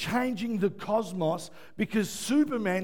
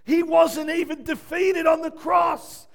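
loud, high pulpit shout; fervent preaching; 8 semitones higher, 7 dB louder than usual. Acted. Preacher insisting to the congregation, loud. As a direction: press it home hard.

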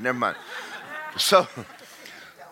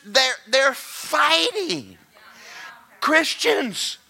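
preaching at full force; 10 semitones higher, 3 dB louder than usual. Acted. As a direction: hammer it home to the congregation. Preaches to the room.

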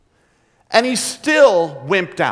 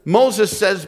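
ordinary delivery

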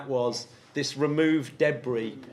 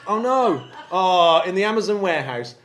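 mock alarm; 5 semitones higher, 9 dB louder than usual.

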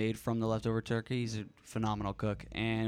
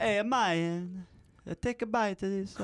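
mock Italian accent; 9 semitones higher than usual. Neighbor offering food to bite, mock Italian accent. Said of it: "Why are they Italian?".

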